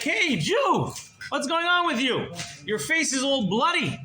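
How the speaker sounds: high, loud, astonished exclaiming; startled bandit. Retold or imitated. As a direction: call out in alarmed disbelief at what you find. Bandit startled by bound captive.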